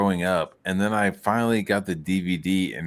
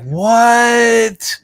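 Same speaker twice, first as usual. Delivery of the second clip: high, loud shocked "What?"; a cry of disbelief; stunned what; 13 semitones higher, 8 dB louder than usual. Retold or imitated. Baffled viewer hearing the director's take, shocked. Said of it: "and I was like".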